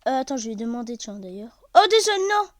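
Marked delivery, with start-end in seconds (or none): 1.8-2.5 high, loud yelp of dismay; startled apology. Real, not acted. Gamer apologizing after a mistake, high loud yelp. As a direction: blurt out sorry in shock. Accidentally hits something.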